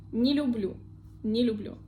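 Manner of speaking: не люблю quickly joined as ni-lyu-BLYU; like one word. Shown as a demonstration. Teacher asking learners to repeat, не люблю as one word. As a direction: say не люблю without a break, "ni" unstressed. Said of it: "Like one word".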